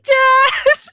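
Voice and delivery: high, loud mock wail; panicked cry. Child calls dad after dog scare, mock panicked wail.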